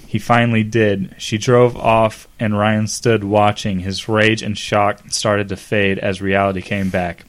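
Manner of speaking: ordinary delivery, nothing marked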